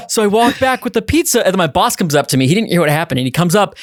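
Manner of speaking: ordinary talk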